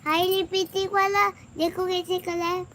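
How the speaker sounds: put-on high little girl voice; child voice. Mother voicing her daughter about the lipstick, high child voice. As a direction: squeal like a small girl admiring lipstick.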